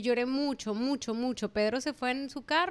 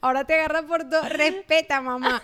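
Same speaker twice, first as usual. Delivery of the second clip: louder, higher teasing retort; mock scolding son; 4 semitones higher, 7 dB louder than usual. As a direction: throw his own words back at him. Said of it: "Y yo". (reproche burlón al hijo, más fuerte)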